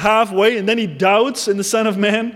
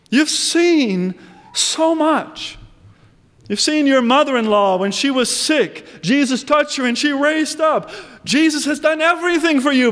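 higher, incredulous scolding of Peter; exasperated rebuke; 6 semitones higher than usual. Acted. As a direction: pitch it up, disbelieving and reproachful. Preacher scolds Peter directly.

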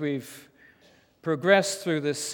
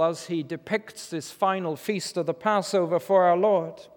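ordinary talk, nothing marked

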